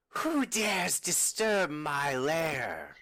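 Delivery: slow, eerie monster voice; ancient rasping undead king. Acted. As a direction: speak slowly from a dark lair. DM voices creature in lair.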